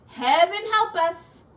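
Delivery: high, loud panicked cry; cried out. Acted. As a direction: cry it out in alarm. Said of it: "Miss Rosemary cried".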